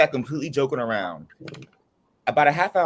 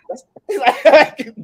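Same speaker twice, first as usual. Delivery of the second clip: laughing; 11 semitones higher, 10 dB louder than usual. Real, not acted. Laughing at the story.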